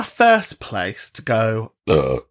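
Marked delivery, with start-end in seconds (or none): 1.9-2.2 low, throaty burp; real burp mid-speech. Real, not acted. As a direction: let a short burp slip out. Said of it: "there's always a burp in there".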